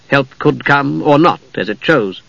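ordinary delivery, nothing marked

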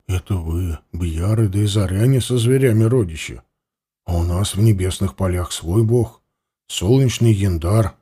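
low, hushed, confused; a bound warrior, unsure; puzzled prisoner. A narrator's reading. Varlyga answers, confused. (низкий растерянный голос)

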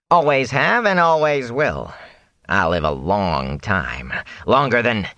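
slow, drawn-out peddler voice; Fane, boastful. Acted. Fane brags to Rand about living long, boastfully. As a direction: stretch the words with swaggering pride.

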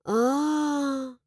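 disappointed, stretched-out realization; disappointed drawl. A narrator's reading. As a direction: draw it out, let down. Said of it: "протянула я разочарованно".

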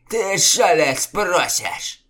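high, loud, slow threat; menacing sneer. A narrator's reading. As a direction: dare him with menace. Chieftain menaces challenger.

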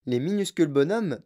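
usual speaking voice

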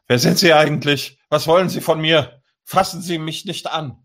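mock yelling in protest; loud yelling. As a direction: act out an outraged cyclist shouting. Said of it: "schreit lauthals".

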